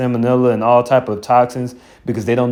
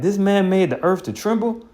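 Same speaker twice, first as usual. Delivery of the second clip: high, scoffing disbelief; can't believe this is him; incredulous question; 8 semitones higher than usual. Retold or imitated. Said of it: "They're gonna be like".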